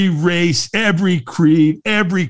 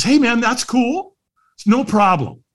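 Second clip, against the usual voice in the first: higher-pitched, unhurried reassurance; easygoing stranger; 9 semitones higher than usual. Retold or imitated. Stranger reassuring a reluctant walker, easygoing.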